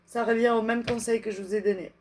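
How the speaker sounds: ordinary delivery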